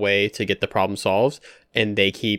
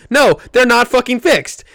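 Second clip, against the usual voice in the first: much higher and louder angry shout; mock outraged shout; 13 semitones higher, 9 dB louder than usual. Friend mocks the unfinished blinds saga, shouting. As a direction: explode on the punchline. Punchline of mock movie rant.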